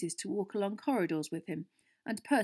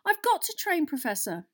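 high, loud plea dropping on the last word; outraged; 9 semitones higher, 6 dB louder than usual. A narrator's reading. Harry insisting to his professor he must train, outraged. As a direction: protest indignantly to a teacher.

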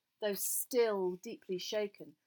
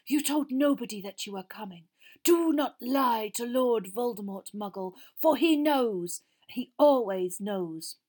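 cold, commanding villain; 3 semitones higher, 7 dB louder than usual. A narrator's reading. Cold master warns Frank.

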